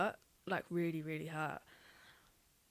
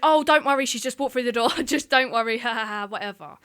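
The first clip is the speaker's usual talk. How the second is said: loud laughing brush-off; 5 semitones higher, 12 dB louder than usual. Retold or imitated. Sister's phone call voiced.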